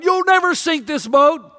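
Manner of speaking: defiant yell. Retold or imitated. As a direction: hurl it at the sky, daring him. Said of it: "he says to God".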